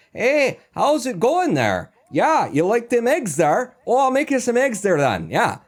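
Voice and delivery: high, slow hammed-up Midwestern accent. Offering eggs to a guest, in an exaggerated Midwestern accent. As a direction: play a folksy Wisconsin host with the Midwestern accent, high and slow. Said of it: "something sort of Midwestern".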